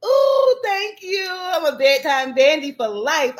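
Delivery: high, squealing thank you; overjoyed at the gift; excited squeal of thanks; gushing thanks. Real, not acted.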